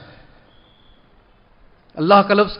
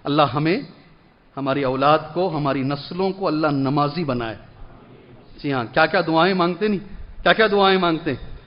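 low, soft prayer voice; gentle supplication; 7 semitones lower, 6 dB quieter than usual. Real, not acted. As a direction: drop into a hushed blessing for the congregation. Prays for congregation's children.

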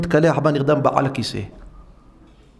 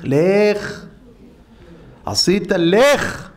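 dragged-out dismissal; 6 semitones higher, much slower than usual. Retold or imitated. Cashier sends him away.

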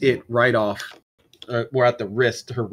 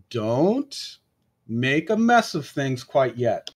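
[name], warning; slower than usual. Acted. Usurper stopping hostage drawing his sword, teasing villain warning. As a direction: playfully halt them, then settle into warning.

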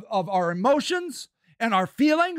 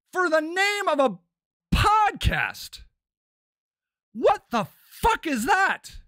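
indignant outrage; 5 semitones higher than usual. Real, not acted. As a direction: push the pitch up in outraged disbelief. Indignant at being publicly shamed.

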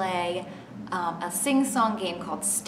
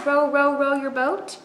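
plain reading voice

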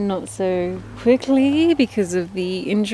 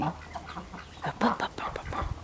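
soft breathy wop wop to the ducks; whispered duck call; 12 dB quieter than usual. Real, not acted. Owner coaxing her ducks with a whispered call. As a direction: whisper the call to coax them.

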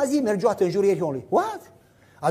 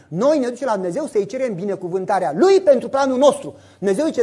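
ordinary talk, nothing marked

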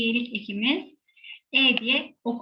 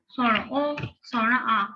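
ordinary talk, nothing marked

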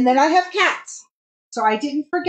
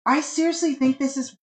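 ordinary delivery

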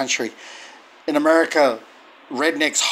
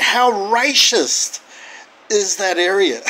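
pitched-up, disbelieving scoff; incredulous scorn; 7 semitones higher than usual. Real, not acted. Scoffing at the no voters, incredulous and pitched up. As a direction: sneer at the area in disbelief. Mocking own area's no vote.

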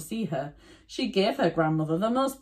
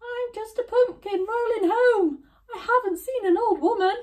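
very high disguised voice; squeaky pumpkin voice. Acted. Old woman disguising as pumpkin.

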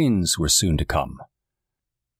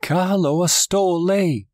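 very high, distraught cry; high-pitched panicked accusation; acted hysteria; 11 semitones higher, 3 dB louder than usual. A narrator's reading. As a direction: blurt out the terrible news in a high voice. Hione's hysterical report of abduction.

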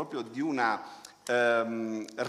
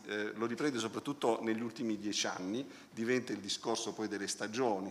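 usual speaking voice

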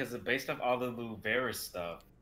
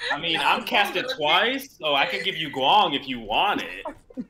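playful protest; 4 semitones higher, 10 dB louder than usual. Real, not acted. Offering a friend her extra role, loud and emphatic. Protesting over being cast twice.

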